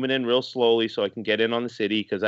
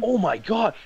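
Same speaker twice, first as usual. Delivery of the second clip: much higher, stunned oh my God; astonished; 11 semitones higher, slower than usual. Retold or imitated. Boston hiker watching the city appear on screen, stunned. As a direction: let the amazement spill out. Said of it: "you could just hear it in his voice".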